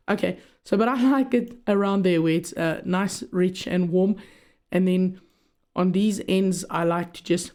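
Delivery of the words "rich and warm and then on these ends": normal talking voice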